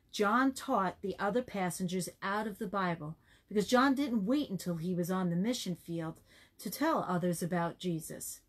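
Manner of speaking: ordinary delivery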